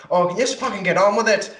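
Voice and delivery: high, loud, fast mock snap; imitating a rude shopper who wants the worker to hurry; impatient customer.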